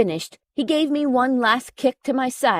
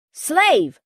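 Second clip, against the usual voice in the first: higher, commanding call across the house; 5 semitones higher than usual. Acted. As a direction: summon a servant from another room. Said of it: "call from the living room".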